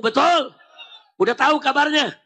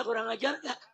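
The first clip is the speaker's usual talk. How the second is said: quiet question; 10 dB quieter than usual. Real, not acted. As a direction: ask it much more softly. Asks crowd softly, then repeats loudly. (bertanya dengan suara pelan)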